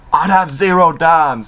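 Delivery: cocky mock punchline; imitating Enzo. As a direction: land the put-down with swagger. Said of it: "pretty much Enzo says".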